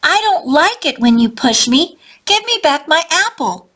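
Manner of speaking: small bug finally standing up to the bully; big voice. A narrator's reading. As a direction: stand tall and say it boldly. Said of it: "says in his big voice".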